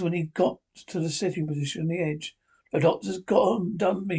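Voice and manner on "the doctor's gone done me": usual speaking voice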